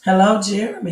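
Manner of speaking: ordinary delivery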